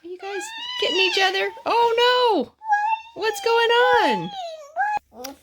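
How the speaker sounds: very high, quick squeal of alarm. Real, not acted. Kids grabbing each other.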